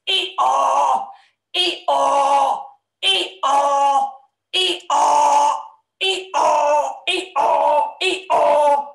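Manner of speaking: repeated donkey bray; Darnell. Pretend. Host showing the donkey to children, braying. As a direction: bray hee-haw on two steady held notes. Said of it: "he's Darnell the donkey".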